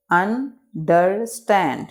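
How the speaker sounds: Understand slowly as un, der, stand; three spaced beats on understand; broken into syllables. Shown as a demonstration. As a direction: pause after each syllable of understand. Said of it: "इसको pronunciate कर लेते हैं".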